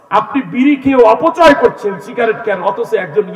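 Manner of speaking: high, forceful preaching shout; loud rebuke. Real, not acted. Preacher rebuking smokers for waste, loud and high. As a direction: scold the crowd at full voice.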